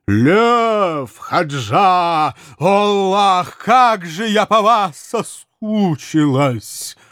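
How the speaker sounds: high, loud squeal of delight; shrieking with joy. A narrator's reading. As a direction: shriek it like a jackpot winner. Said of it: "едва не завизжала от радости".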